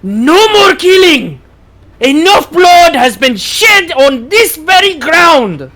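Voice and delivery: high, loud, drawn-out proclamation; impassioned shout. Acted. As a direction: slowly thunder it out like a heroic speech.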